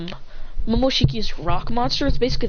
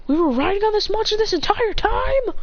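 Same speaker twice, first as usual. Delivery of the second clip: high-pitched disbelief; acted shock, pitch raised; incredulous outburst; 11 semitones higher than usual. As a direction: question it in astonishment. Troy shocked the mountain is alive.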